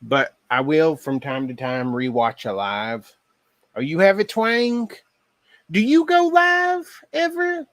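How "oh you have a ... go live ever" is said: surprised reading. Real, not acted. Reading viewer comments aloud.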